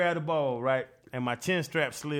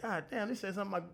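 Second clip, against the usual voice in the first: stunned mutter; 5 semitones higher, 5 dB quieter, faster than usual. Retold or imitated. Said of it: "you be like".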